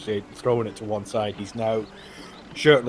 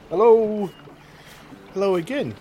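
Ayla voice; 5 semitones higher than usual. Acted. GM voices Ayla greeting players.

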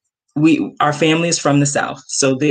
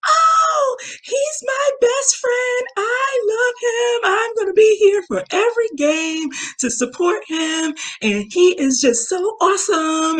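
put-on gushing girl fan. Girl telling mom about her best friend, gushing. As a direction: imitate an adoring young girl, pitched way up. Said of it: "biggest cheering squad".

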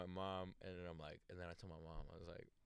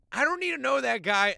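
much higher and louder, an imitated disgusted teen; mock shrill sister; 19 dB louder than usual. Teen sister cutting off her brother, mock high and loud. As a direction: play the squeaky offended kid sister. Sister retorts in retold car story.

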